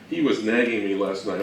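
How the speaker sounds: usual speaking voice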